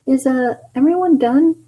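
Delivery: very high squeaky question; Tails voice. Acted. Tails asking if everyone finished, very high. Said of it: "why do I say it like that?".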